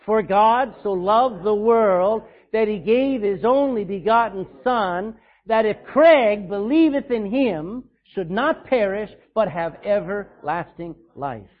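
slow, loud, every word spelled out together; loud congregational recitation. Pretend. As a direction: proclaim the verse aloud as one. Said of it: "Say your name this time again".

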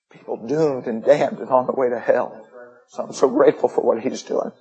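real emotion welling up mid-sermon; moved, holding back feeling while preaching; getting emotional. Real, not acted. Missionary to congregation about people lost in China, getting emotional. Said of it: "Forgive me for getting emotional".

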